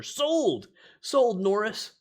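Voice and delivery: high, loud eager exclamation; excited. Real, not acted. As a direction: snap it up eagerly. Wants the boots.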